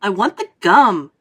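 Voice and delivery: loud, stubborn demand; obstinate. A narrator's reading. Violet to her mother, loud and stubborn. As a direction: dig in like a spoiled kid. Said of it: "Violet said obstinately".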